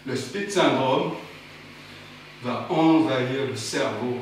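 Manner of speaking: low, slow ominous tone. Acted. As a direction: announce it like a dark threat.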